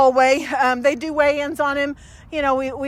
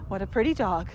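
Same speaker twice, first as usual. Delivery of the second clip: soft, low, calm greeting to a dog; 7 semitones lower, 10 dB quieter than usual. Retold or imitated. Visitor calmly admiring a dog, soft and low. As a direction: greet the dog quietly and evenly. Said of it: "people don't come up to a dog in your house and go".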